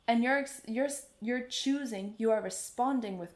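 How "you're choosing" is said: usual speaking voice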